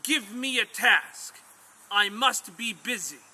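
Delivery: a demon hungry for work, pleading loudly; eager demon. Acted. Demon begging its master for work.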